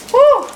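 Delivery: high, loud "woo"; delighted whoop. Real, not acted. Cheering over her greens.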